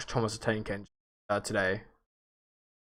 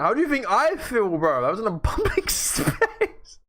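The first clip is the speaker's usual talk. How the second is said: embarrassed laughing; 9 semitones higher than usual. Real, not acted. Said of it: "secondhand embarrassment".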